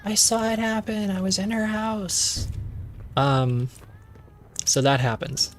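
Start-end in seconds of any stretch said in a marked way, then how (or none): 0.0-2.5 much higher, softer shocked voice; whisper-cry it like a haunted witness; high mock-frightened voice; high frightened woman. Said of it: "she was like".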